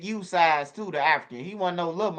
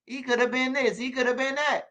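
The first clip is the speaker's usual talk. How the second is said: Trump impression; 8 semitones higher than usual. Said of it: "like Trump say".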